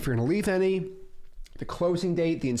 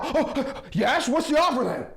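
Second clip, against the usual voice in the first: demanding seller; 9 semitones higher, 3 dB louder, slower than usual. Retold or imitated.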